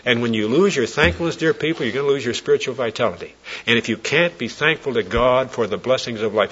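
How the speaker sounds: normal talking voice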